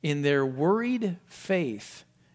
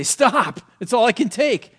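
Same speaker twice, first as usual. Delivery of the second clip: louder and higher, crying out to make it stop; overwhelmed cry to stop; 8 semitones higher, 7 dB louder than usual. Retold or imitated. Preacher voicing the disciples shouting at the storm, loud and higher. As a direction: call out as if the storm is too much. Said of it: "they stood up to say".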